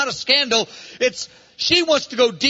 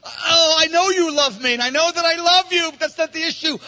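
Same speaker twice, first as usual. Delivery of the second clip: crying out to the Lord, near tears; bride's tearful outburst; anguished cry; 5 semitones higher than usual. Acted. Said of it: "And she's going".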